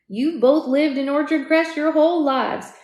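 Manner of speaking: playful husband ribbing his wife; light teasing. Acted. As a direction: tease her gently about the pact. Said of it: "he teased lightly".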